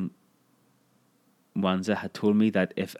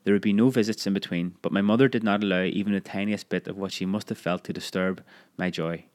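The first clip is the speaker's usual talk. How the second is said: usual speaking voice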